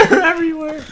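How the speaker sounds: high shriek of laughter into words; laughing panic. Real, not acted. Gamer swamped by enemies everywhere, high laughing panic. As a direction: squeal it through helpless laughter.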